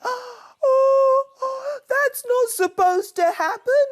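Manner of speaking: high, crying, upset cub; gulping in dismay. A narrator's reading. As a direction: wail in sudden panic. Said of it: "he gulped".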